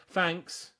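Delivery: high-pitched put-on thanks; mock British tourist. Tourist grabbing shopping at the till, high mock thanks. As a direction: imitate a tourist's token thanks. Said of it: "and they go".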